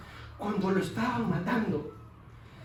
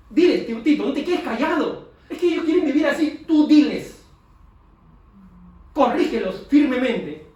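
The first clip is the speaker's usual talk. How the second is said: louder, urgent exhortation; fervent preaching; 6 semitones higher, 8 dB louder than usual. Acted. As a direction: urge Tito on, building to a shout. Preaching intensely.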